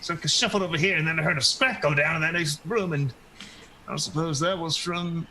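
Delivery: put-on fussy elderly character explaining herself; cantankerous old woman, flustered. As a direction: ramble on as a confused, put-upon crone. Game master plays old woman.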